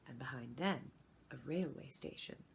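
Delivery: usual speaking voice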